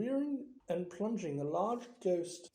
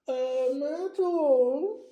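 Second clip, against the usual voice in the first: slow, high booming call, much louder; bellowed; 8 semitones higher, 8 dB louder, much slower than usual. Acted. Peeves calling Myrtle over to make trouble, bellowing. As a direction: bellow her name across the hall. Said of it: "He took a deep breath and bellowed".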